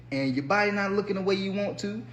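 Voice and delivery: ordinary delivery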